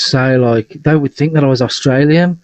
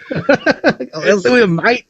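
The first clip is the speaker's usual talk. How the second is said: high, drawn-out Australian parody; hammed-up slow Australian accent; mock Australian accent; 8 semitones higher, much slower than usual. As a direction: mimic the botched Australian accent back. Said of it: "Well, I'm not Australian".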